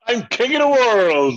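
loud, high, drawn-out triumphant cry; Titanic king of the world. Pretend. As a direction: shout it from the ship's bow. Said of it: "No, I'm not Jack from the T-, Titanic".

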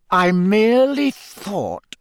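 slow, high, strained calm; forced calm. A narrator's reading. Snape excusing himself to Moody, forced calm. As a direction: stretch it out, holding composure by force. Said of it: "in a voice of forced calm".

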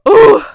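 high, loud cartoon yelp; sudden loud mock cry of impact; pained "ooh". Imitating her hitting her head with a toy, loud yelp.

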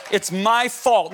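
ordinary talk

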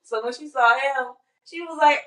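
ordinary delivery

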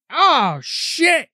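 high, loud retold "oh, shit"; mock pained dismay. Podcaster voicing the villain struck by a hammer, mock dismay. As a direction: act out a sudden painful realisation. Acting out man hit with hammer.

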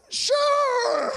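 very high, loud, drawn-out squeal; hammed-up agreement. Accepting a burger from strangers, hammed-up squeal. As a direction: squeal it out for laughs.

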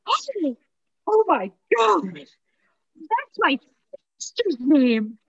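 excited. Retold or imitated. Guy reacting excitedly on phone.

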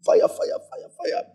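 very high, rapid chant of fire; fiery prayer cry. Retold or imitated. Preacher mimics fiery prayer.

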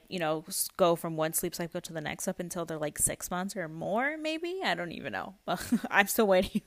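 ordinary talk, nothing marked

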